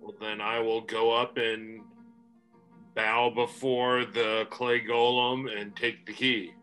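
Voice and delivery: ordinary talk, nothing marked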